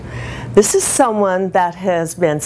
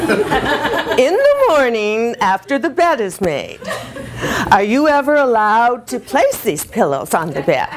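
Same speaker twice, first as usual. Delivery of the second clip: mock three-star whine, pitched higher; raised-pitch, exaggerated husband's whine; aggravating whine; 5 semitones higher than usual. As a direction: imitate a husband's aggravating complaint. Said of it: "goes right to three-star wine".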